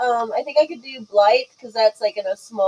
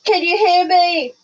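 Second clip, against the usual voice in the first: high, raised call across a distance; yelling out; 8 semitones higher, 4 dB louder, slower than usual. Acted. Pippa calls to Wendelin across yelling distance, yelling out. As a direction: call out loudly to a friend far off. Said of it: "she's gonna yell out to Wendelin".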